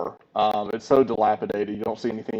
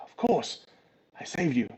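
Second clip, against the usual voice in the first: lightly voiced, impatient shield companion; soft, slightly exasperated little voice; a hint of a cheeky small creature; talking shield; 5 dB quieter than usual. Game master plays the talking shield.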